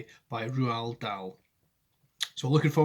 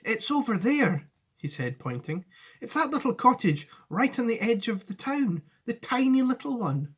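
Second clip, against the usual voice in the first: put-on raised, lightly eager boy; Charlie's voice; 9 semitones higher than usual. Charlie showing Wonka his house, raised. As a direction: point it out gladly.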